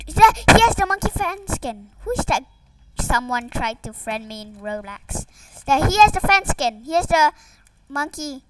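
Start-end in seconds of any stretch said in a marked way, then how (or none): 0.1-2.4 loud, higher excited call-out. Real, not acted. Gamer spotting the monkey fan skin, excited. 5.7-8.4 loud, higher excited call-out. Real, not acted. Gamer spotting the monkey fan skin, excited. Spots rare skin again.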